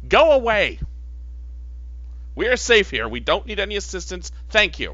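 high, loud yell through a door. Acted. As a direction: shout them away through the door.

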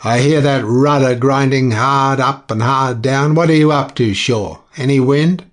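captain calling up, loud and testy; captain from below. A narrator's reading. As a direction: call up demanding answers. Captain calls up from cabin.

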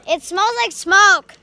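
loud, high alarmed call; loud exclamation. Real, not acted. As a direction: blurt it out loudly.

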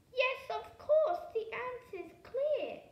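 high, loud eureka moment; excited. A narrator's reading. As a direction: burst out with the idea. Sudden good idea.

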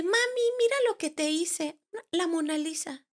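put-on a small girl proudly showing mom her drawing; little daughter. Mother retelling her toddler showing a drawing, high childlike voice.

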